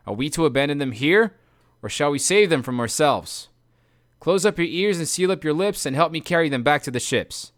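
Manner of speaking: loud call to arms; rallying shout. Acted. Odysseus to his fifty men, rallying shout. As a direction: rouse the men, commanding.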